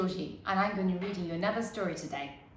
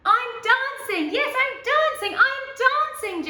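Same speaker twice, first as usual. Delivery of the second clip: joyful cry; 8 dB louder than usual. Acted. Gerald discovering he can dance under the moon, overjoyed cry. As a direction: burst out in wonder at yourself. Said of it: "Gerald cried".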